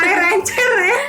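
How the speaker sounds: high laughing mock; teasing through loud laughter. Real, not acted. Mocking friend's mispronunciation, laughing.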